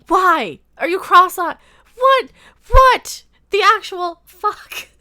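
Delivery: loud, high shouts of disbelief; appalled, incredulous outburst; exasperated yelling. Real, not acted. Ranting at cross-eyed cartoon horse.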